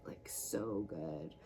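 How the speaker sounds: blissful whisper. Real, not acted. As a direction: draw it out in a slow, soft whisper. Rolling the feet.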